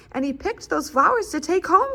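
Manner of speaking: a touch of a cute little critter; Hedgehog's voice. A narrator's reading. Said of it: "said Hedgehog".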